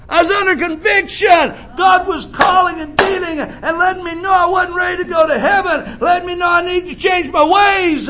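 high, ringing preacher's cry; preaching at full force. Acted. Preacher tells the church of his mother, full-force preaching. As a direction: preach it out to the congregation.

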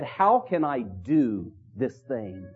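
low, slow, hushed self-questioning; asking himself. Retold or imitated. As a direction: ponder it softly as if alone. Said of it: "Joseph asking the question to himself".

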